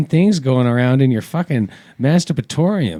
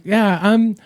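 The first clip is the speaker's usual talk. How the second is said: a hint of a higher, slow girlish brag; lightly mimicked girl; 7 semitones higher, slower than usual. Girl trying to impress him, lightly mimicked. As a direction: lightly voice a girl name-dropping her dad.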